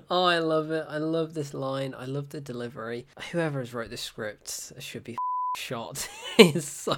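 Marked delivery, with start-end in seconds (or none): none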